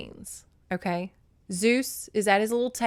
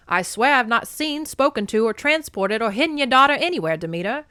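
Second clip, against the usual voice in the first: put-on quick, loud old-movie patter; nineteen-forties actor; 5 semitones higher, 8 dB louder than usual. Hermes swears innocence to Demeter, old-movie actor voice. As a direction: play it like a forties film actor. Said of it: "do one of those, like, nineteen forties actors".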